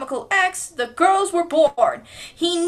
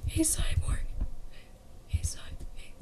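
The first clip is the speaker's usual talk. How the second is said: soft unvoiced whisper; 3 semitones higher, 10 dB quieter than usual. Real, not acted. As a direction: hiss for Cyborg's attention quietly. Whispering to get attention.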